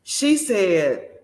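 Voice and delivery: ordinary talk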